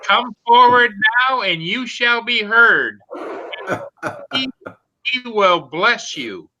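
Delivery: slow, grand proclamation; town crier. Pretend. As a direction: announce it like a herald. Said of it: "I've got plenty of hear ye".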